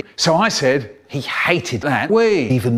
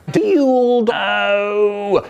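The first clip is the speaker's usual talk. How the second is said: word stretched out long in a silly, higher voice; funny long voice; 5 semitones higher, 3 dB louder than usual. Retold or imitated. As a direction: drag out "Dildo" in a goofy put-on voice. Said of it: "really long with a funny voice going".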